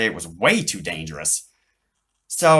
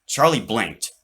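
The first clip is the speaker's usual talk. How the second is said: ordinary delivery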